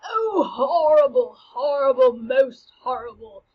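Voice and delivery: loud, drawn-out ghostly lament; horrified ghost. Acted. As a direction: cry out slowly as an appalled ghost.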